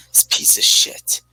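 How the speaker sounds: a contemptuous whisper; whispered insult. Real, not acted. Venting about his drunk busser, whispering the insult. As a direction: hiss it under your breath with disdain.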